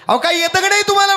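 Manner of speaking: high, fast shouted taunt; mocking shout. Son mocks father shouting.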